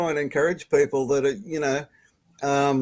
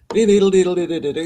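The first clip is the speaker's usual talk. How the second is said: imitating organ music; 6 semitones higher, 5 dB louder, faster than usual. Mimicking Bach on pipe organ.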